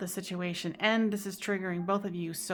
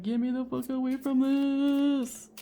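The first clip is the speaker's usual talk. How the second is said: mock panicked plea; 5 semitones higher, 3 dB louder than usual.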